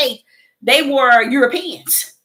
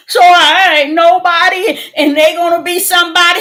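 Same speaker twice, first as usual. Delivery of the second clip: louder and higher through tears; a retold cry of hurt, voice breaking; 6 semitones higher, 7 dB louder than usual. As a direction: sob it out, feeling small. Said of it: "I went back to crying it again".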